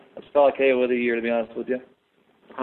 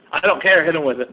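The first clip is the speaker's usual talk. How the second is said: loud, higher mock coach command; imitated coach yelling; 3 semitones higher, 5 dB louder than usual. Coach brushing off fighter's pulled groin, loud imitated order. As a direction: bark it like an impatient coach.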